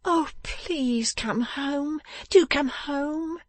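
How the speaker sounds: high, slow, tearful pleading; wailing wife's entreaty; sobbing. A narrator's reading. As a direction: beg through sobs in a high voice.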